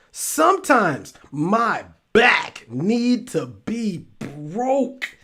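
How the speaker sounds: very slow, with "back" punched and "broke" drawn out; emphatic stress on BACK. Real, not acted. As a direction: separate each word, hitting "back" hard. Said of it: "why do I keep emphasizing the word back".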